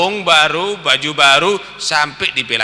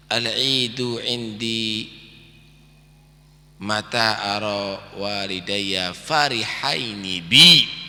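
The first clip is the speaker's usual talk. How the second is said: Arabic recitation; 4 semitones lower, much slower than usual. Acted. Preacher quoting the child's saying in Arabic, slow. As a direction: intone the Arabic line slowly.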